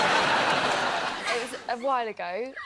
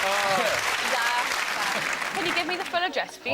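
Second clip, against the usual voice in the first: put-on Welsh accent; slower than usual. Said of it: "obviously Welsh is easy".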